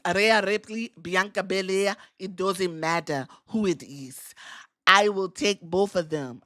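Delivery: playful attempt at a Japanese accent; mock Japanese accent. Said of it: "I don't think that's a Japanese accent".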